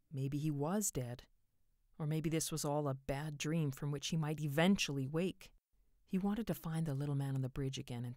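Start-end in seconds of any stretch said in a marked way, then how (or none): none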